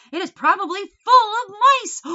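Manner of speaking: high, loud, alarmed protest; shrill up to voice the fussy wife's fear of mice. A narrator's reading. Wife protesting to the bellboy, high and loud. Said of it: "Missus Gridley made one last protest".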